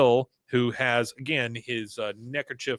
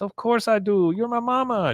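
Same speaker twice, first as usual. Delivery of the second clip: higher reassuring son voice; voicing Con higher; Con's higher voice; 9 semitones higher than usual. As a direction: reassure mama in a raised voice. Con voiced in retold scene.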